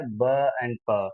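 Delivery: ब and प said very slowly, each initial sound held apart; slow sound demo. Shown as a demonstration. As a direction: spell out ब and प one sound at a time. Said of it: "इसका जो initial sound है". (ब और प धीरे-धीरे अलग करके)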